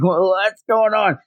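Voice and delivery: high-pitched flustered question; hammed-up baffled voice; mock bewilderment. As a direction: squeal it in comic confusion. Stunned character acted in retelling.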